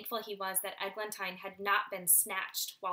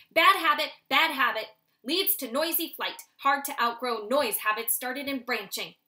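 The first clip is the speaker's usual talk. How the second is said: loud, bossy father owl; stern father; 3 semitones higher, 8 dB louder than usual. Acted. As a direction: coach him firmly like a strict dad. Father lectures son.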